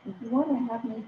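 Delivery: muffled, like talking underwater; muffled bad-mic underwater sound. Real, not acted. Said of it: "It sounds like you're underwater or something".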